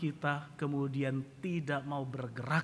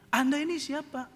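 high-pitched, affronted challenge; Pak Mukidi; 12 semitones higher, 4 dB louder than usual. Retold or imitated. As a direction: challenge the pilot, mildly irate. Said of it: "Pak Mukidi marah-marah". (bertanya ketus dengan nada tinggi)

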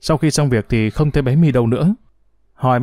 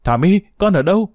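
cuống cuồng gọi con; 6 semitones higher than usual. A narrator's reading. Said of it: "cuống cuồng gọi con".

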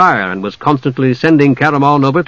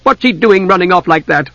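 higher, sharp exasperation; a touch of angry scolding; 6 semitones higher than usual. A narrator's reading. Desra complaining about the elf, mildly angry. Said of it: "Desra said angrily".

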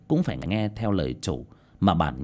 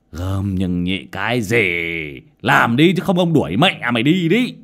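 angry boss; 6 dB louder than usual. A narrator's reading. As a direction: bark the threat down at the worker. Boss threatens stammering worker. (quát gắt, cao và to)

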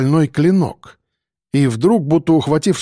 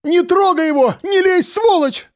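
very high-pitched squealed cry; woman's shriek. A narrator's reading. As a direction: shriek it to stop the attack. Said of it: "Взвизгнула вдруг Лиза".

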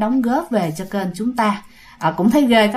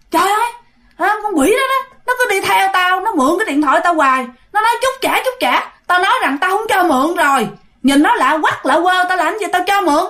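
put-on high, agitated drunk complaint; drunk man's rant; 8 semitones higher than usual. Drunk man telling the stranger about the ghost, high and agitated. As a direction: rant like a tipsy man annoyed.

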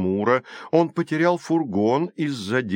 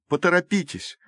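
high, urgent entreaty; pleading; 8 semitones higher than usual. A narrator's reading. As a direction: beg them to hurry. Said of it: "взмолилась Калипсо". (настойчивая мольба)

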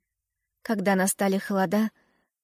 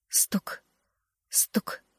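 whispered; 7 dB quieter than usual. A narrator's reading. Heartbeat sound, whispered.

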